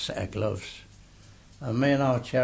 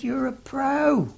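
high-pitched mock indignation; indignant exclamation; 4 dB louder than usual. Beaten sparring partner protesting to the narrator, high and indignant. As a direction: voice Cherry's outrage, pitched up.

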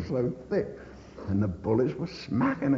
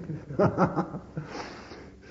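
breathy laugh; 8 semitones higher than usual. Real, not acted. Veteran laughing at his own bloody head, breathy laugh.

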